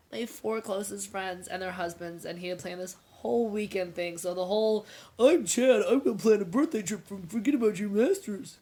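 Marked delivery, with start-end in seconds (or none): 5.2-8.6 lightly voiced mocking husband, louder; a hint of a smug boyfriend voice; mocking Chad. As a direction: puff it up like a self-absorbed husband. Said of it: "'cause that's how he sounded".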